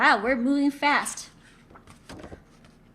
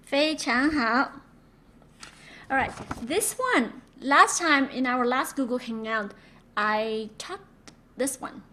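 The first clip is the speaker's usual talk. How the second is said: ordinary delivery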